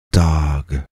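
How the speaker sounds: ordinary delivery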